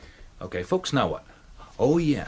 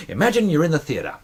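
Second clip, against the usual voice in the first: ordinary talk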